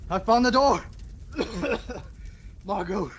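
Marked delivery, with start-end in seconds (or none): 0.9-2.6 rough smoky coughing fit; coughing in the burning hall; choking cough. Acted. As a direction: choke on the smoke. Choking smoke.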